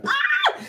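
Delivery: short, very high shriek of disgust; real shriek of disgust. Real, not acted. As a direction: let out a sharp grossed-out scream. Reacting to gross story.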